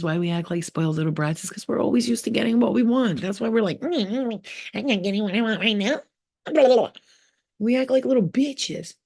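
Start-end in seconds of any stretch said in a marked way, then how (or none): 3.8-6.0 high mock whining child; whiny brat. Speaker mocking spoiled people, high whiny brat. 6.4-6.9 high, louder mocking babble; dismissive mock babble; mock blah blah. Speaker mocking complainers, high mock babble.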